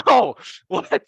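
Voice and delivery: high, loud, startled "what?" with a laugh; real laughing surprise at the sound; surprised laugh. Real, not acted. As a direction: burst out laughing in disbelief. Reacting to the translator's roar.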